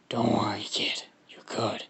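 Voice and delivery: husky creature voice, fading to whisper; Venom. Acted. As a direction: redo it fuller, then sink into whispery rasp. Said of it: "Venom in his head, he's like".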